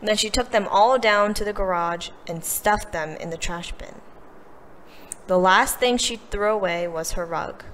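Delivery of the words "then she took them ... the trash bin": ordinary delivery, nothing marked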